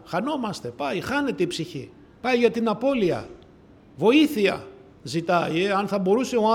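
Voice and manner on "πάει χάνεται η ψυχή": ordinary delivery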